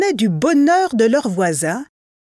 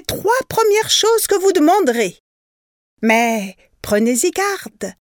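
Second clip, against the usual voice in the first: fairy voice; 8 semitones higher than usual. A narrator's reading. A fairy warning the poor couple, high and solemn. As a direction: speak as a grand fairy granting wishes.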